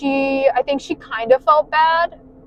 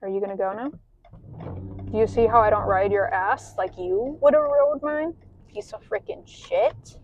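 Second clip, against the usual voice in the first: softer, grumbling at the car ahead; real angry muttering; 6 dB quieter than usual. Real, not acted. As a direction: mutter it under your breath at a tailgater. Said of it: "sorry, I'm pissed off".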